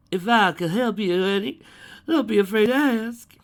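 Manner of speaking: loud, high Southern country howdy; full country accent. A narrator's reading. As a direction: greet them like a country shopkeeper. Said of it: "in a full country accent".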